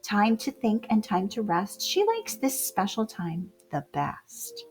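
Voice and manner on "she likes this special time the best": usual speaking voice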